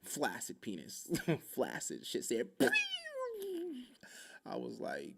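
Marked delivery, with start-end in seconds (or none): 2.5-4.4 comic pop and falling whistle; mouth-made slide down into deflation; imitated falling noise. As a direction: whistle like something suddenly drooping. Imitates going flaccid.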